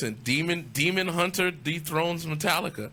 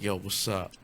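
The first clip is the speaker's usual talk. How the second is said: low, softened mock come-on; imitating a woman with a deep voice; 9 semitones lower, 5 dB quieter than usual. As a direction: drop the voice low for an imitated chat-up line. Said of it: "that deep voice".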